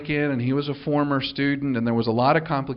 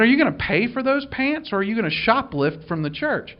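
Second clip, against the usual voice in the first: much higher, teasing smirk; 8 semitones higher than usual. Retold or imitated. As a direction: ask it again with a knowing smirk. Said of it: "the smirk that most of you have seen".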